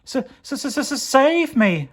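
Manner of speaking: very high, stammering "s-, s-" before "save me"; stuttering plea. Acted. Pear begging for rescue, high frightened stutter. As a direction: stammer in terror. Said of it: "stuttered Pear".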